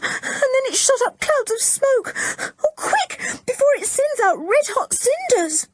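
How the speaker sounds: very high, breathless, close to tears; trembling girl begging Mister Luffy to hurry; terrified pleading. A narrator's reading. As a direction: blurt it out in terror. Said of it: "the trembling girl".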